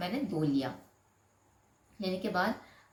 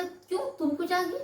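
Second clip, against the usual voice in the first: husband's incredulous question, pitched much higher; mock husband; 10 semitones higher than usual. Wife mimics husband refusing ladies' section, high and incredulous. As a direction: mimic the husband's baffled objection. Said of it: "मैं बोला".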